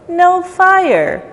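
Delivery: ordinary delivery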